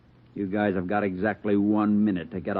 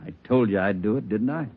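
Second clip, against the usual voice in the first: normal talking voice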